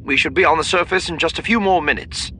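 high, clipped officer over the intercom; crisp military voice. A narrator's reading. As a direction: report it like a precise pilot. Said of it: "the military precise voice came over the intercom".

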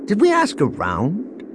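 high, theatrical question. A narrator's reading. Man feigning puzzlement to companions, theatrically. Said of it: "Han asked theatrically".

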